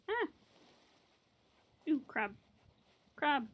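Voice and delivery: ordinary talk, nothing marked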